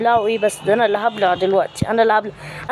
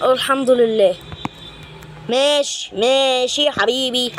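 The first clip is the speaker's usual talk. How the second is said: ordinary talk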